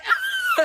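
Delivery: very high, loud shriek; hammed-up female scream; mock scream. She screams as the wave hits, mock shriek. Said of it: "So they're screaming".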